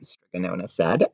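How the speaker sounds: ordinary delivery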